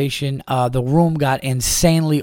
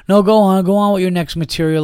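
higher, louder mocking challenge; goading heckler; 6 semitones higher, 3 dB louder than usual. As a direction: lightly imitate a heckler egging someone on. Acted heckler goading the comic.

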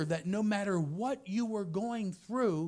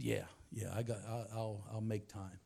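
humbled reply; 9 semitones lower, 9 dB quieter than usual. Retold or imitated.

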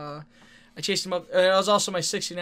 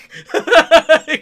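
loud laughter; 10 semitones higher, 17 dB louder than usual. Real, not acted. Laughing at the scared opponent.

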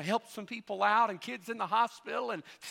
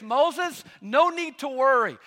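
higher, louder, comforting Moses; reassuring God; 6 semitones higher, 7 dB louder, slower than usual. Retold or imitated. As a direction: tell Moses not to worry, upbeat. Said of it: "He says".

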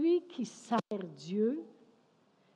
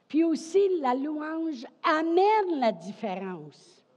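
AMÈNE drawn out and punched in a raised preaching voice; emphatic proclamation; 7 semitones higher, 7 dB louder, slower than usual. Real, not acted. Preacher adds that praise brings change, emphatic proclamation. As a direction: proclaim it slowly, leaning hard on "amène".

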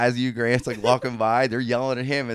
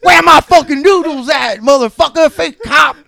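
high, full-force taunting shout; yelling inmate; 14 dB louder than usual. Retold or imitated. Inmate extra heckling the actor playing an officer, loud angry yell. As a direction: yell it across the cell block at the fake cop. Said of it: "these guys are yelling shit".

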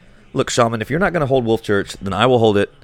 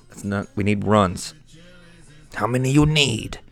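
usual speaking voice

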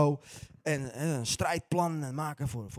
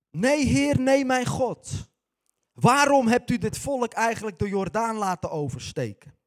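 higher, louder, slow lament; anguished lament; 5 semitones higher, 6 dB louder than usual. Acted. Jozua lamenting to God after defeat, anguished and slow. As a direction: cry out to God in despair.

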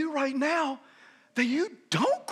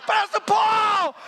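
higher and louder mock protest; mock outraged shout; 10 semitones higher, 5 dB louder than usual. Pastor protesting to a fellow pastor, mock outraged shout. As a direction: shout it at a friend in disbelief. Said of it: "I was like".

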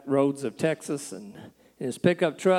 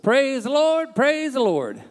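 high, louder chant of praise; eager, raised-pitch repeated call; urging the horse on; 8 semitones higher, 4 dB louder, slower than usual. Acted. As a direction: call it out to spur a horse faster. Rider urges horse to go faster.

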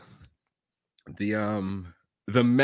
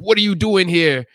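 higher, louder suspicious question; louder pointed question; suspicious challenge; 8 semitones higher, 9 dB louder than usual. Retold or imitated. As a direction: challenge him like a wary employee. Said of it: "he's like".

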